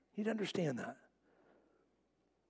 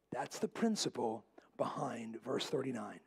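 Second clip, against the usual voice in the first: whispered aside; 10 semitones lower, 6 dB quieter than usual. Acted. Preacher drops to a whisper.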